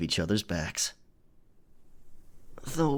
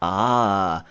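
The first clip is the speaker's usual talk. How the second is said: higher, slow, dreamy mock echo of the listener's words; teasing mimicry; 3 semitones higher, 6 dB louder, slower than usual. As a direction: tease by parroting them sweetly. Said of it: "I'm only teasing".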